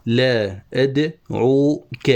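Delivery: very slow, broken into syllables; scanning a foot. Shown as a demonstration. Teacher marking where the foot ends, slow scansion. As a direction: draw out "لا أدعوك" piece by piece. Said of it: "وهنا تنتهي التفعيلة".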